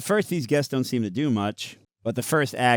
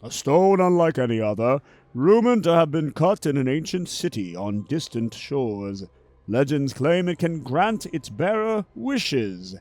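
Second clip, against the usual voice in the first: slow, grand and louder announcement; triumphant host; 6 semitones higher, 5 dB louder than usual. Acted. Host continues grand speech.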